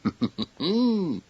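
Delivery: high giggle; a quick high giggle. A narrator's reading. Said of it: "He giggled".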